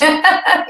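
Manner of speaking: quick bright laugh at her own line; a little giggle after the joke; short laugh. Real, not acted. Co-host laughs at her own line, giggling.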